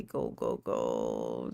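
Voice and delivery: breathless whisper urging herself on. Real, not acted. Muttering to herself while pasting in a whisper. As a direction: hush it out under your breath.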